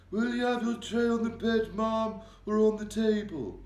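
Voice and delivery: high, loud, deferential servant asking; an old maid serving breakfast respectfully; polite maid. A narrator's reading. Maid offering the Queen her breakfast tray, deferential. Maid's voice.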